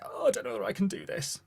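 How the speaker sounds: high, wavering newcomer's worry; self-doubting. Retold or imitated. Voicing nervous newcomers to the choir.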